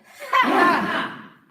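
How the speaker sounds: loud chop yell breaking into a laugh; yell into laughter. Real, not acted. Group chopping the axe, a loud yell into laughter. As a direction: shout it out then laugh. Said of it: "and then chop through it".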